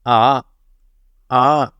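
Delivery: "Ah." sliding from low up to high; rising second tone. Shown as a demonstration. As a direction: sweep "Ah." upward. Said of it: "start with a low sound and go to a high sound".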